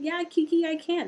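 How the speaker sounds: put-on high little-kid answer; toddler voice. Nephew answering his aunt Kiki, high toddler voice. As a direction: play the two-year-old owning up. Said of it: "did you say I can't".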